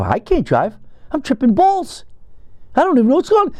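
jumps way up high on tripping balls; giddy high-pitched I'm tripping; high excited quote. Retold or imitated. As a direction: sell how wasted you are, pitch climbing. He says he's tripping.

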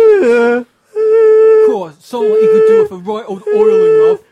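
loud, high cockney bloke; cockney geezer. Acted. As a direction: play a dodgy cockney geezer. Improvised character, loud.

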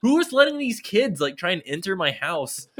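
high-pitched outraged rant; indignant complaint. Retold or imitated. Homeowner about kids trying to enter, indignant outburst. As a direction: demand an answer in exasperated disbelief.

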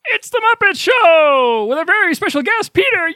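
very high, very loud show opener; hammed-up shouted fanfare ending in a long cheer; Muppet-style announcer yell. Host opening the Muppet sideshow, wildly cheering announcement.

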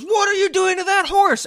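very high, loud mock outcry; imitated frantic high-pitched yell; imitating Tom Green. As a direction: shout it high, as if running onto the farm. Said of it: "runs onto this farm, and goes".

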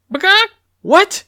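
very high, loud chicken squawk; shocked high-pitched cluck. A narrator's reading. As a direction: squawk it like an alarmed hen. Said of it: "clucked Clucky".

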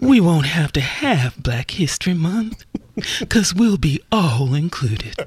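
low, put-on film speech; speechify low as the man in Sounder. Redoing the one day speech on request, low Sounder voice. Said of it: "in the Sounder voice".

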